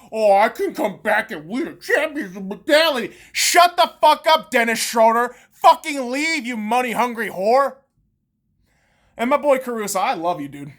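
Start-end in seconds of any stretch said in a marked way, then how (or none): 0.1-3.1 sneering parody of a player; mock-boastful voice. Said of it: "all that shit you're saying". 3.3-7.8 angry. Real, not acted. Angry rant at player.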